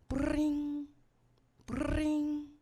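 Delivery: high sung bring bring; phone ring imitation. Imitating the phone ringing before dad answers, high.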